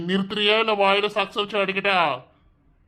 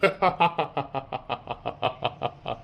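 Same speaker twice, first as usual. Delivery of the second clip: villain laugh; 4 semitones lower, 5 dB quieter than usual. Acted. Villain laughs at her plea.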